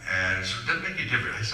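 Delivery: normal talking voice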